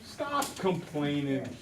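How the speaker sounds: annoyed parent. Retold or imitated. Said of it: "they're like".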